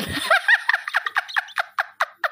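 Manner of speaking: high squealing laugh; a burst of shrieking laughter. Real, not acted. Laughing at mixed ancestry. (tawa melengking)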